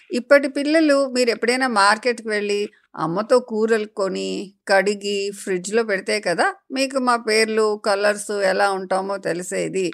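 plain reading voice

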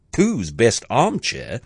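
plain reading voice

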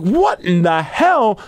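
drawn-out "what in the hell"; slow stunned disbelief. Retold or imitated. Friends watching the stomp, slow amazed disbelief. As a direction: stretch it out, amazed.

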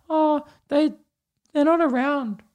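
put-on high small child's voice; sad little kid. Kid named covid tells the teacher about his parents, high child voice.

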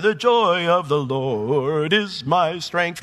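"The joy of the Lord is my strength" drawn out very slowly, like a memorised slogan; a worn-out church saying, dragged and drawn; slow sing-song recital. Retold or imitated. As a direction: recite it slow and dreamy, the way it was always repeated. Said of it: "I used to hear that forever".

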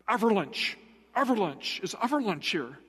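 imitated Japanese-accented mailman; quick Japanese-accented calling of the dog's name. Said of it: "he would say it kind of fast".

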